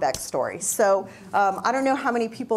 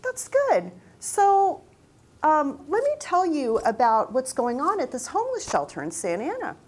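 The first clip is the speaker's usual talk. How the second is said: sweet loving voice; 6 semitones higher than usual. Retold or imitated. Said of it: "this really sweet, loving voice".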